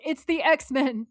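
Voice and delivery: loud, slow, drawn-out It's the X-Men; excited exclaim. Retold or imitated. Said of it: "I was like".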